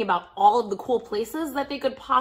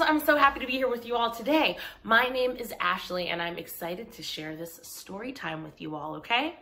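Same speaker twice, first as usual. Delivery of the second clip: ordinary talk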